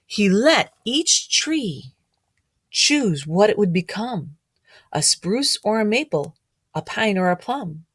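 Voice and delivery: storybook read-aloud, gently rhythmic; reading to children. A narrator's reading. Teacher reading a picture book rhyme to children.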